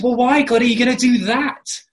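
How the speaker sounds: loud, high retold protest; crying out. Preacher voices Habakkuk questioning God's plan, crying out loudly. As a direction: throw the question up at God in protest. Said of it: "then he cries out again".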